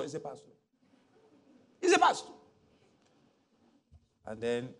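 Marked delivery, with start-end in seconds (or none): 1.8-2.3 eager mock reply. Imitating cousin again.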